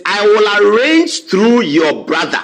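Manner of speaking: slow, deliberate reading of the reply; reading out slowly. Retold or imitated. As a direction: lay out each word of the text. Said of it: "Godfrey Dame says".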